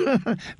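breathy little chuckle mid-line; soft laugh. Acted. Noblewoman chuckling about her son. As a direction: laugh softly between words. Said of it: "Lady Merriweather gave a laugh".

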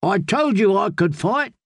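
high call, no real volume; shouted down. A narrator's reading. Squire on the wall to the swordswoman, mild shout. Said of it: "he shouted down".

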